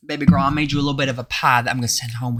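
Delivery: folksy Southern grandma, fast; sweet Southern auntie voice; Southern accent. Acted. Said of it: "Southern accent".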